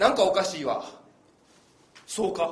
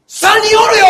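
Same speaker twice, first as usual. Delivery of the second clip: put-on kid shouting; 11 semitones higher, 16 dB louder than usual. Shun-kun insists loudly.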